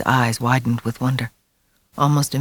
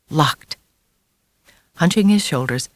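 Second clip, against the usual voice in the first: ordinary delivery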